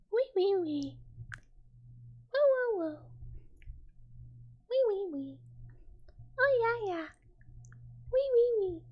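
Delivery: high, falling cat-like squeals; high voiced kitty cries, sliding down; little cat noises. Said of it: "I love a little cat noise".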